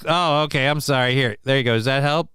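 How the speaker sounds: normal talking voice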